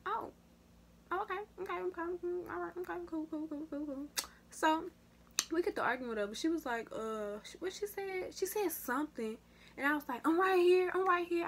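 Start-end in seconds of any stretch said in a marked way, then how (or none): 0.1-4.1 mock unbothered. Mock calm reply to insult. 10.3-11.5 loud, high taunt of I'm right here; taunting shout. Retold or imitated. A girl taunting her rival before a fight, loud and high.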